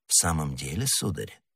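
hushed, low, unruffled question; very calm. A narrator's reading. Stranger answering the Gascon's outburst, utterly calm. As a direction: ask softly and coolly. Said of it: "ещё более спокойным тоном".

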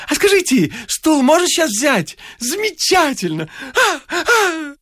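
very high, squealing enthusiasm; bleating. A narrator's reading. Excitable stranger collecting his newly bought chair, high bleating. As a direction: bleat it out far above your own pitch. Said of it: "Беспрерывно блея".

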